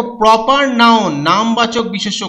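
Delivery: usual speaking voice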